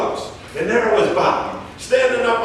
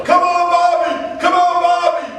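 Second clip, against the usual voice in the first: retold yelling; 10 semitones higher, 3 dB louder than usual. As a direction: yell like the lone fan in the stands. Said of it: "He was yelling".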